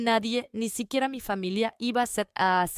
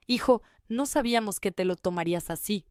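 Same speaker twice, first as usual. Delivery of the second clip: plain reading voice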